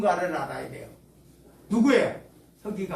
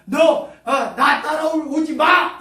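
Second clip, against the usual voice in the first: slow, higher, louder mock shout; mock harsh rebuke; 5 semitones higher, 8 dB louder than usual. Preacher mimics a harsh Jesus, mock shout. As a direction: shout the follower away in mock anger. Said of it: "이렇게 말하는 게 아니에요".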